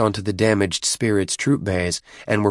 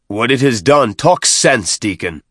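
exasperated demand; 3 semitones higher, 5 dB louder than usual. A narrator's reading. Chieftain losing patience with the deacon, exasperated. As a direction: demand sense, raising your voice.

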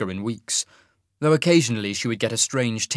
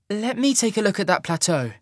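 higher woman's voice, brisk; young woman, defusing; 9 semitones higher than usual. Acted. Sasha to the quarrelling pair, a higher brisk offer. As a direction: step in to calm things. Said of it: "she said".

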